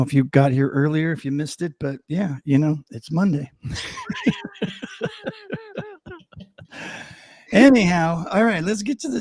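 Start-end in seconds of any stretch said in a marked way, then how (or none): 3.5-5.8 high-pitched burst of laughter; laughing. Real, not acted. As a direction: crack up laughing. Laughs at it being Monday.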